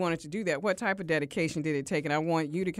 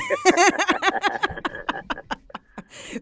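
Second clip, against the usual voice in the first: high bursting laugh; loud laugh; 13 semitones higher, 6 dB louder than usual. Real, not acted.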